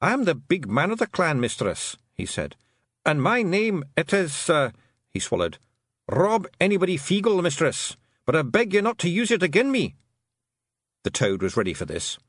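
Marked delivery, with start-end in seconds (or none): 0.0-2.0 high Scottish clansman; pitched-up little chief; Feegle clan chief. Acted. Feegle leader formally introduces himself. 3.0-4.7 high, slow Scots stalling; nervous little clansman; hesitant Feegle chief. Acted. Nervous chief hesitates over name. 6.1-10.0 high Scottish plea; pitched-up, worried clansman; anxious Feegle chief. Acted. Chief gives his name, begs.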